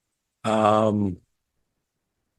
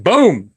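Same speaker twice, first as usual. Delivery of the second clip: a sharp, higher, louder spoken boom; mock gunshot; 7 semitones higher, 4 dB louder than usual. Reviewer retelling the vigilante's shot, mock gunshot. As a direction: punch out a gunshot sound.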